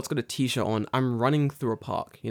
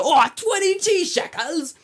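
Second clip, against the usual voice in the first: very high, drawn-out shock; hammed-up high, slow gasp of surprise; mock astonishment; 4 dB louder, slower than usual. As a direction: squeal the price slowly in disbelief. Acts out astonished reaction to price.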